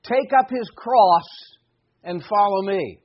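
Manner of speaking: ordinary delivery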